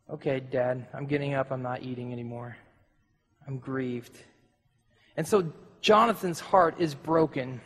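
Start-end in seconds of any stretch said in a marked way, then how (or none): 0.1-4.2 low, much softer, dejected; a hurt son giving up quietly; grieved son. Retold or imitated. Jonathan leaves the dinner table after the spear, soft and dejected.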